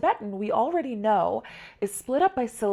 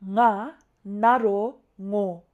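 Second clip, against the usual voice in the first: "nga, naro, ngo" slowly, part by part; step-by-step oral spelling; 4 dB louder, much slower than usual. Shown as a demonstration. Teacher demonstrating Tibetan spelling, recited step by step. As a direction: spell it out: letter, vowel name, combined sound. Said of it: "say first the nga, and then the name of the vowel".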